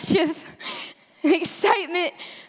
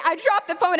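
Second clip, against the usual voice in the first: plain reading voice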